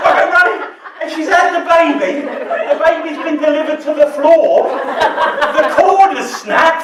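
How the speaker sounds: a midwife laughing as he relives the floor birth; laughing through the story. Real, not acted. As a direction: tell the shock while laughing loud. Laughing while retelling the birth.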